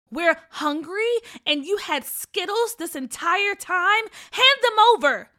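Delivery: loud, high and slow, building to a shout; indignant yell. A narrator's reading. Hungry sister demanding her brother's candy, indignant yell. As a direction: drag out the outrage, then shout. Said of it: "Gigi yelled".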